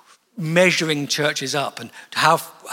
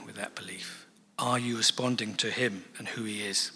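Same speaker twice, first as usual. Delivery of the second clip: whisper; 5 semitones lower, 9 dB quieter than usual. Real, not acted. Preacher drops to whisper.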